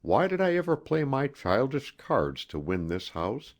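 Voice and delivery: ordinary delivery, nothing marked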